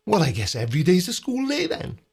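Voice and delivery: ordinary delivery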